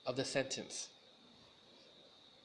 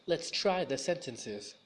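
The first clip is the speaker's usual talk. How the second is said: normal talking voice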